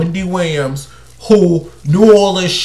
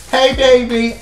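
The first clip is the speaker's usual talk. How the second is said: mock clingy girlfriend; 7 semitones higher than usual. Mocking a clingy wife.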